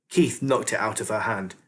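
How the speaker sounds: ordinary delivery, nothing marked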